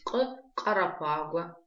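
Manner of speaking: «К-карапагуа» медленно, с отдельным «к»; сначала резкое «к», затем растянутое «карапагуа»; гортанное к в слове. Shown as a demonstration. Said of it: "Легче ему так запомнить". («К-карапагуа» по частям, как образец)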